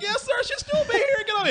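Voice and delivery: high, overly sweet shooing; chirpy mascot brush-off; mock polite bear. Bear shooing drunk guest along, high and sugary. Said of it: "He's like".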